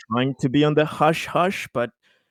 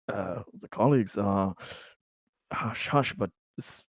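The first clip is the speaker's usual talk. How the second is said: soft, low, halting businessman voice; hesitant corporate type; 5 semitones lower, 6 dB quieter than usual. Acted. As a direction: feel out a discreet business pitch, haltingly. Plays Conrad, a corporate buyer.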